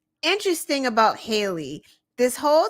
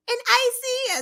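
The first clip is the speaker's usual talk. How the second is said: put-on much higher, childlike piping; small boy voice; 14 semitones higher than usual. The boy tells DeSantis what he's eating, childlike and high. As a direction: squeak it like a little kid. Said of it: "the little boy says".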